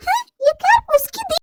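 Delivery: high, sing-song taunting echo of her words; mocking echo. (ऊँची आवाज़ में चिढ़ाती नकल)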